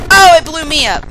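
dismayed shout. Real, not acted. Player just blown up, dismayed shout. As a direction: exclaim at being blown up.

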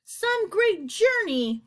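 high, very drawn-out mock praise; sarcastic grumble. A narrator's reading. Centipede limping after the fall, high drawn-out sarcasm. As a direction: stretch it slowly, pitched high.

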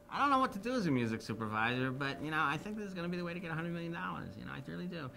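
a light impression of the music supervisor, breezy and sure; lightly voiced Jimmy, upbeat; imitating Jimmy. Impersonating Jimmy Iovine.

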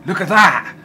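loud high cry of alarm; shocked outburst. Real, not acted. Gamer spotting a huge monster, shocked outburst. Seeing a big enemy appear.

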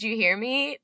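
ordinary talk, nothing marked

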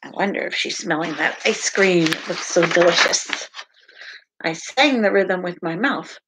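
lively, sing-song picture book read-aloud; animated storytime voice with playful sound words; reading to children. Acted. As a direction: read it out to little listeners with bounce.

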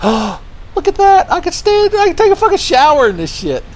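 giddy high-pitched yell; amazed squeal. Real, not acted. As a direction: squeal with disbelief at standing in lava. Amazed standing in lava.

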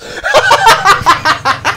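high giggling fit; high, helpless laughter. Real, not acted. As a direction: crack up at your own silly joke. Cracks up at his joke.

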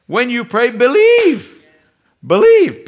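high, loud rising exhortation; building to a loud preaching climax; preaching at full force. Acted. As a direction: drive the word believe home. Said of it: "preaching builds".

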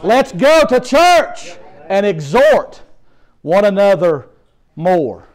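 loud, high preacher's call to church; rally the congregation to church; ringing sermon cry; preaching at full force. Real, not acted.

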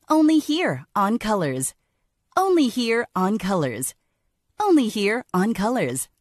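announcer sign-off; promo tagline. Shown as a demonstration. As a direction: land the channel name. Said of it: "Like this".

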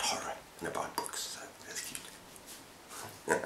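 whispered fond aside, almost under the breath; soft whisper. Real, not acted. BookTuber whispering about loving his wife, softly. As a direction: hush it like a little secret.

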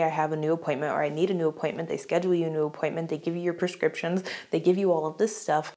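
normal talking voice

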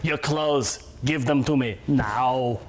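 slow Austrian robot menace; flat, slow Terminator demand; Arnold impression. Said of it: "I'll do an impression too".

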